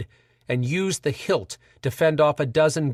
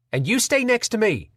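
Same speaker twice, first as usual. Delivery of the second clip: firm command; 8 semitones higher than usual. Acted. Pam commanding Henry in chaos.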